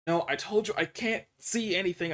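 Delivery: ordinary talk, nothing marked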